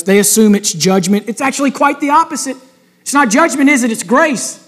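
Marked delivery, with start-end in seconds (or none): none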